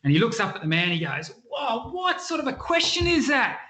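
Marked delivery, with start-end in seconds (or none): 1.5-3.6 much higher, incredulous worker's voice; exasperated labourer. Acted. Quarry worker scoffs at the visitor in a high, incredulous voice. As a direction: scoff at the silly question from up high.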